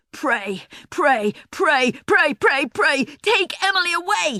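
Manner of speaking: building to a loud shout. A narrator's reading. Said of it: "he shouted".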